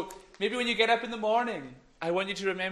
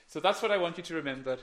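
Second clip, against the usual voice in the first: ordinary talk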